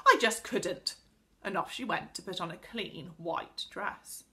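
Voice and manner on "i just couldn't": loud, dramatic refusal; appalled cry. A narrator's reading. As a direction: refuse as if it were unthinkable. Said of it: "cried Connie".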